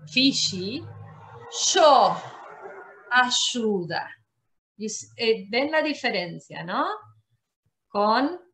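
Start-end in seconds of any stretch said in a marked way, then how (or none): 3.1-4.2 "ayuda" said "ashuda"; Argentine sh. Shown as a demonstration. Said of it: "La sh, muy fuerte".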